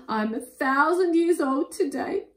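high, loud mock wail; hammed-up tearful dragon voice; sobbing. A narrator's reading. Dragon weeps on his birthday, hammed-up high sobbing. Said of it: "he sobbed".

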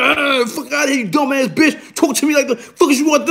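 high-pitched mock rage; retold wild ranting. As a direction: act out losing it mid-tirade. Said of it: "I started wilding".

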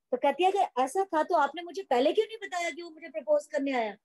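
ordinary talk

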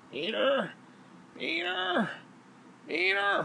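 high calls of Peter, rising each time; imitating the neighbour calling from the hallway; calling old woman. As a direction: call his name up the hall in a high voice.

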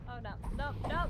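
ordinary delivery

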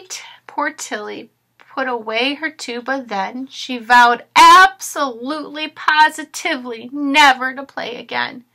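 ordinary talk, nothing marked

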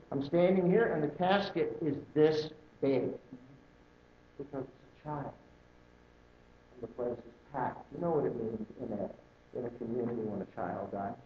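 hushed solemn. Real, not acted. Solemn memory of child funeral.

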